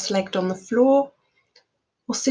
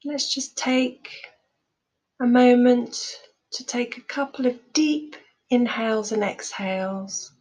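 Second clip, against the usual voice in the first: calm, measured meditation voice with long pauses; guided meditation. Real, not acted. Teacher leading listeners through loving kindness meditation, calm guided delivery. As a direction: guide them gently, leaving space after each phrase.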